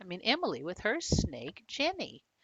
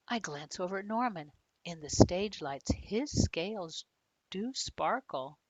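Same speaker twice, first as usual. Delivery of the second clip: ordinary delivery, nothing marked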